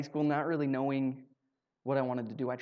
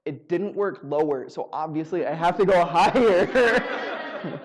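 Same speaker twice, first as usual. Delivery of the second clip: laughing; 5 dB louder, much slower than usual. Real, not acted.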